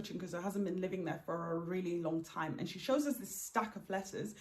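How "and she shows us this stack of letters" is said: ordinary talk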